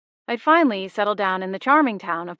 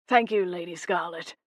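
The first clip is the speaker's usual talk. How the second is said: smug English ghost, lightly voiced; self-satisfied thanks. A narrator's reading. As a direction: thank her with a pleased grin. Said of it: "with a self-satisfied grin".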